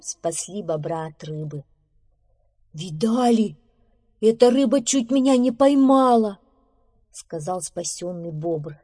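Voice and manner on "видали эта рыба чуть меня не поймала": loud, high, indignant amazement; excited exclamation. Acted. Rescued beaver to his friends, excited. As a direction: blurt it out loud after a narrow escape.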